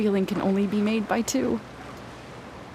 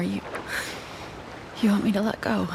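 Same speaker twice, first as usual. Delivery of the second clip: normal talking voice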